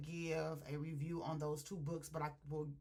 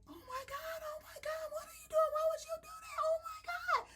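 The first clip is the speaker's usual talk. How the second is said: very high, wailing mock panic; hysterical. Woman who just saw her man shot, hysterical. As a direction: imitate a woman shrieking in tearful horror. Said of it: "So she's all hysterical".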